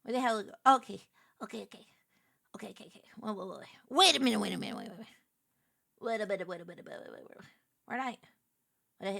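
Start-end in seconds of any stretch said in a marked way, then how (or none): 1.4-3.7 whispered okays under the breath. Real, not acted. Streamer hunting for a file, whispering to herself. Searching quietly for something. 6.5-7.5 whispered waits. Real, not acted. Searching quietly.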